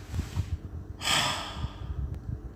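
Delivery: long unvoiced sigh of sorrow; heavy sigh. Real, not acted. Preacher sighs at the pain of racism, heavy sigh. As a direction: let out a weary, breathy sigh. Pained pause in sermon.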